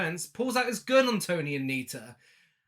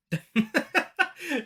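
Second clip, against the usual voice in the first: real laugh rising higher; 11 semitones higher, 4 dB louder than usual. Real, not acted. Host laughing at Lois's retort, higher laugh. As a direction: laugh out at the line. Laughs after quoted comeback.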